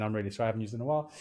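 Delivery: usual speaking voice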